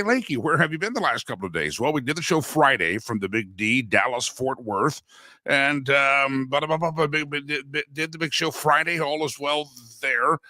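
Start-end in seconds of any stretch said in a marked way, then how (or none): none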